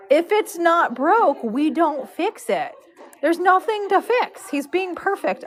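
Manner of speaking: high, drawn-out retold insistence; emphatic reasoning. Braider telling owner to leave him be, emphatic. Retelling her firm reply to owner.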